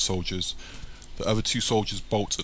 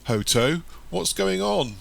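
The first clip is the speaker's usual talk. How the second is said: high, distressed question; mildly acted near-tears; almost tearful; 9 semitones higher, slower than usual. A narrator's reading. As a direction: ask in a higher, upset voice. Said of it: "Sam replies almost in tears".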